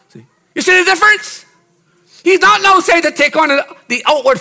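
high, loud, exhorting preacher; full-force preaching. Real, not acted. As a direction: fire off commands to the congregation, pitched high.

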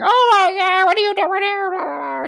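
put-on very high, slow, indignant girlfriend voice; storming out shriek. Sick girlfriend catching him at her door, high indignant shriek. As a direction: screech it like she's mortified. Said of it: "she came storming out".

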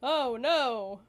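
drawn-out sarcastic pity; mock sympathy. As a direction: feign dismay, slowly and emphatically. Sarcastic sympathy for such parents.

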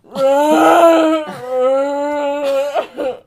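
loud strained groaning moan; guttural zombie moan. Retold or imitated. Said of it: "all you can let out is guttural moans".

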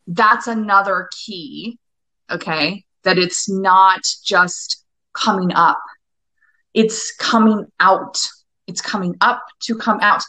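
spaced, slow mantra delivery; slow and deliberate. Shown as a demonstration. Coach slowing down to teach her key mantra, deliberate. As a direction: lay each word down slowly. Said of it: "Repeat that to yourself".

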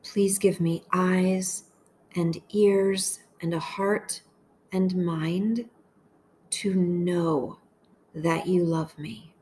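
slow, measured meditation voice with long pauses; unhurried, deliberate phrases for listeners to echo; guided prayer meditation. Acted. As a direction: pray each short phrase slowly, leaving space to repeat it.

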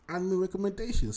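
hyped segment announcement. Acted.

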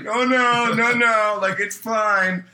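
high, loud, stretched-out noes; manager's strained reassurance. Retold or imitated. Manager covering for screaming cooks to diners, high reassurance. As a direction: insist all is fine, far too high. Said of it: "watching Justin try to be like".

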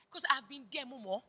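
ordinary delivery

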